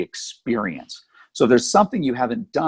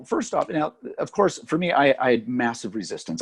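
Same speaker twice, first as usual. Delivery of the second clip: ordinary talk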